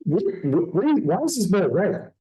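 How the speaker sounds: stammering high-pitched disbelief; mock flustered questioning; baffled shock. Acted shock at red ball.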